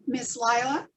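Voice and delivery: usual speaking voice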